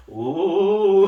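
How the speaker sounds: mock spooky ghost "ooh"; playful ghost howl; ghost wail. Imitating a ghost haunting someone.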